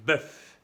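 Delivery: bœuf with a firm lip B; teacher models the bœuf B for students; French bœuf. Shown as a demonstration. French B in bœuf. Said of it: "Con la B de bebé es".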